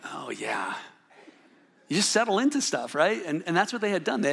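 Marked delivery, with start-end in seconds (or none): 0.1-0.7 sheepish whisper. Retold or imitated. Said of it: "I'm like".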